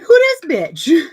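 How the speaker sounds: high, loud, stunned mock outrage at a new character; incredulous outrage. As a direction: blurt it out in shocked disbelief, imitating the boyfriend. Boyfriend meets Venus, incredulous.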